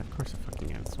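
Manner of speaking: whispered under the breath; muttered aside. Real, not acted. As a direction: grumble it under your breath.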